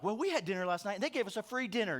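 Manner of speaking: a mild, smug brag in a quick, breezy voice; smug one-upping. Retold or imitated. A bragging topper outdoing a friend's dinner story, mildly smug. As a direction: top their story with lazy mock pride.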